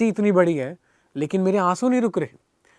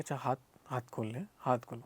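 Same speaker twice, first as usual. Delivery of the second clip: much softer, lower, half to himself; puzzled self-mumble; 5 semitones lower, 13 dB quieter than usual. Retold or imitated. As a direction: murmur the instruction back, not sure what it means. Confused, repeating the guru's instruction.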